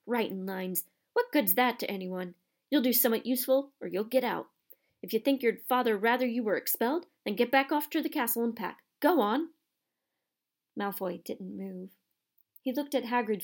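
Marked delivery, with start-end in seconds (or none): 0.1-9.5 stern scolding. Acted. Hagrid scolding Malfoy.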